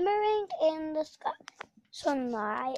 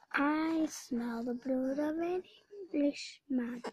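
menacing whisper; 7 dB quieter than usual. Acted. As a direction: whisper it like a threat. Giant sniffs, menacing.

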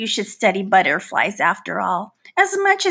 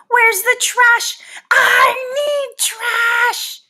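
desperate shout; 11 semitones higher, much slower than usual. Acted. Trapped fly begging for trash, high desperate shout. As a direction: cry out for garbage in desperation.

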